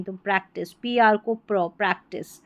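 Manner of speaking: normal talking voice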